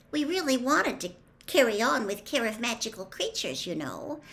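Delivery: put-on high, earnest girl's voice; tentative female voice, dropping at the end; timid girl. A narrator's reading. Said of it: "said Hermione timidly".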